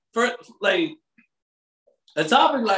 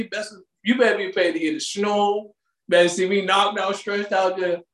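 ordinary talk, nothing marked

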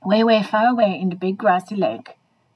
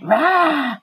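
higher, drawn-out mock roar; sudden roar; 4 semitones higher, 3 dB louder than usual. Stick startles the dog, mock roar. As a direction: let out a pretend monster roar. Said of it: "and then the stick went".

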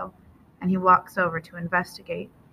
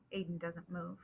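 ordinary delivery, nothing marked

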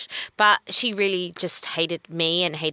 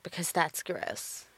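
disapproving; 3 semitones lower, 11 dB quieter, slower than usual. Retold or imitated. Repeats the disapproving comment for emphasis.

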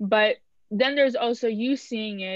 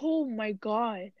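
stunned disbelief; much slower than usual. Real, not acted. Stunned that friend never saw her.

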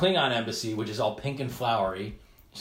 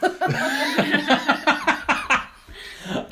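high, loud hearty laugh; big burst of laughter; 7 dB louder than usual. Real, not acted. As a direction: crack up loudly. Laughing at editing joke.

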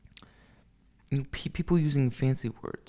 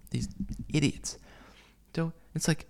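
ordinary talk, nothing marked

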